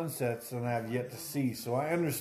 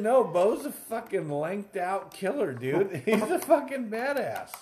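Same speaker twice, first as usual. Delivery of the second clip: laughing protest; 7 semitones higher than usual. Retold or imitated. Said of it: "I said".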